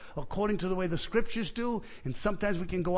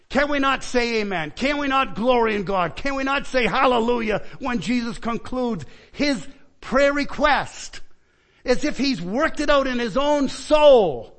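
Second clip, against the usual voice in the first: raised, emphatic pulpit delivery; impassioned preaching; 4 semitones higher, 5 dB louder than usual. Acted. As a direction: preach it out with mounting force. Preacher rises to full force.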